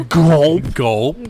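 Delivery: mimicked gulp sound; comic swallow noise; cartoon gulp. Real, not acted. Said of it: "sounds like a real cartoon gulp from Goofy".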